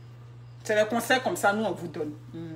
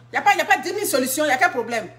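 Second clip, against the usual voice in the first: fast, higher insistence; rapid insistence; 3 semitones higher, faster than usual. Real, not acted. A woman insists there is one solution, in rapid insistence. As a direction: rattle it off insistently.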